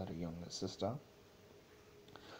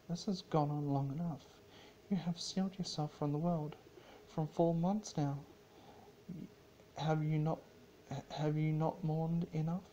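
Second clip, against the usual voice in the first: raised, firm royal sister; Luna's stern voice; 8 semitones higher than usual. Acted. Luna urging Celestia, in a raised, firm voice. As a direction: press her sister to stop grieving.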